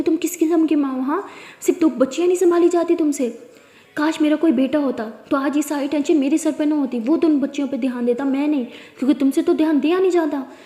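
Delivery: father shouting at the mother; furious yelling. Acted. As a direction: yell at your wife. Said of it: "बहुत चिल्ला रहे होते हैं".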